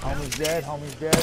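loud, urgent call to the team; urgent callout. Real, not acted. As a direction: shout it across the fight. Teammate down, calling out.

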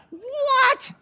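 very high, loud squawk of disbelief; shocked reaction. Acted. Concertgoer reacting to [name] beatboxing, loud high shock. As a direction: shriek it as a baffled fan. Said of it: "And you're like".

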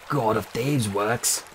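sneering snake. Acted.

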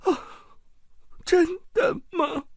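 high, crying plea for reassurance; tearful question. Acted. Aunt crying as she asks her husband if it's true. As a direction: ask through sobs, hoping. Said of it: "莱莎边哭边问".

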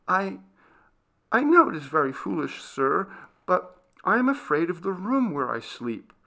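put-on high, hesitant girl's voice; nervous, apologetic young maid; timid servant girl, confessing fear.

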